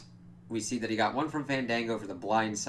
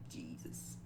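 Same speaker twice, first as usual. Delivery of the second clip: exasperated whisper; 5 semitones lower, 15 dB quieter than usual. Real, not acted. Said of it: "I'm like".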